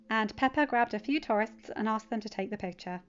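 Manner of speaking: usual speaking voice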